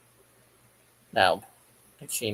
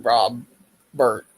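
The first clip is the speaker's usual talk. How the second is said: "Rob", a pause, then louder "Bert"; name split in two; 7 dB louder than usual. Retold or imitated. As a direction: repeat the broken name as "Rob, Bert" with a gap. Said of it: "I don't know when I said".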